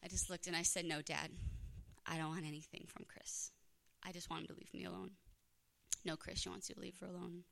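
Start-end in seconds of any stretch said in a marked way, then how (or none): 0.8-5.1 much softer, deflated answer; defeated. Retold or imitated. Daughter giving up in front of her dad, soft and defeated. Caught out, she gives up. 6.0-7.4 softer, subdued passing on; quiet relay. Retold or imitated. Dad telling the ex on the phone, quiet and subdued.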